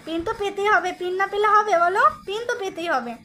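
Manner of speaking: ordinary talk, nothing marked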